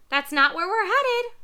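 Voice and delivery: high, loud squawky parrot. Acted. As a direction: chirp it out like an anxious parrot.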